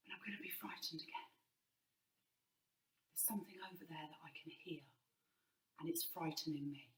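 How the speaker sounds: fast breathy whisper, hushed; scared hushed whisper; frightened whisper. Shown as a demonstration. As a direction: whisper so nobody hears you. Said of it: "You become quieter".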